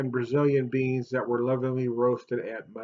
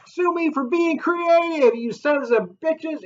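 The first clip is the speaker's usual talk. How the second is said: mock defiant; 6 dB louder, slower than usual.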